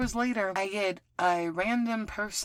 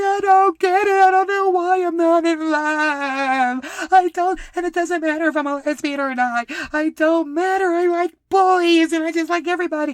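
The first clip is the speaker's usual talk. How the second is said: loud, high, tearful wail of frustration; crying outburst; 10 semitones higher, 11 dB louder than usual. Retold or imitated. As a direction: cry it out loud, voice breaking. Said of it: "So now I'm crying in my feelings".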